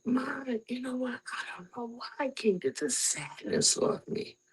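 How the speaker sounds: soft, heavy retold confession; sad hush. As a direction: confess it quietly, barely holding on. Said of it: "I said".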